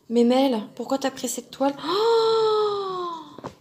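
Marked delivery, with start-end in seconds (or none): 1.9-3.5 long, high drawn-out "ohh"; high wail of surprise at a daunting task; dismayed "oh". Real, not acted. Dismayed at the amount of backstitch. (long « oh » aigu, effaré)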